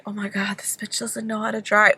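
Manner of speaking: hushed whisper to herself. Retold or imitated. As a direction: whisper so the driver can't hear. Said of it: "I'm like".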